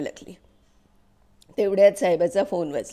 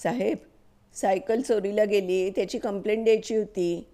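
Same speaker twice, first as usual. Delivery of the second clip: ordinary delivery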